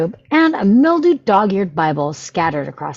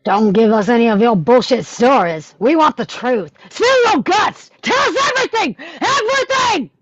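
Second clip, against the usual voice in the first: thug interrogation; 7 semitones higher than usual. Acted. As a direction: squeeze the truth out of him. Gangster interrogating Chunk.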